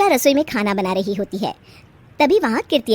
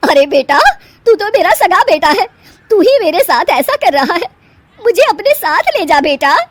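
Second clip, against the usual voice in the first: loud, higher, hurt pleading; a mother's loud, higher plea to her son; 7 semitones higher, 7 dB louder than usual. Acted. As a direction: beg your son not to leave you.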